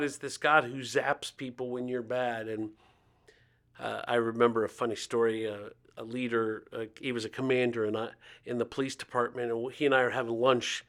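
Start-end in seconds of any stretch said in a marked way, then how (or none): none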